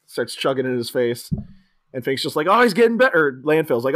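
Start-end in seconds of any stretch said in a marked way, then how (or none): 2.5-3.4 high, loud delight; excited cheer. Acted. Host as Fink watching the chugging, excited cheer. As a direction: cry it out in mock amazement.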